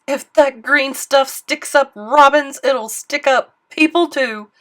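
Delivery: loud, slow frightened warning; fearful old man. Acted. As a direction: voice his dread. Bell ringer fears for people.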